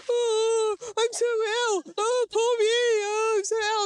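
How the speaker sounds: high, whimpering mock self-pity; mocking whiny sick voice. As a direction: parody her as a moaning invalid. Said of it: "When he does an impression of me".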